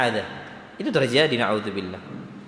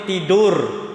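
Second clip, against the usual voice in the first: ordinary delivery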